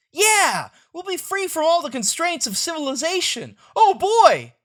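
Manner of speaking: high, loud and eager; thrilled boy; excited. Acted. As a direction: cheer about the adventure. Kids excited about adventure.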